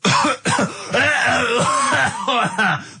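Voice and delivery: choking cough fit, high and strained; real coughing fit. Real, not acted. Radio host coughing mid-show on crisps gone down wrong way. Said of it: "gone down the wrong way".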